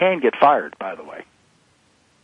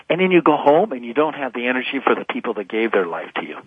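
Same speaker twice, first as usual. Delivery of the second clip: ordinary talk, nothing marked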